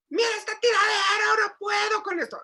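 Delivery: high, near-crying mock yell; hysterical yelling. Mother crying out over the mess, high tearful yell. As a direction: wail it like a mother losing control. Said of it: "gritas como loca histérica".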